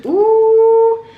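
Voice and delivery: high, loud delighted ooh; excited ooh. Real, not acted. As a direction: squeal with delight at the food. Delighted at the food.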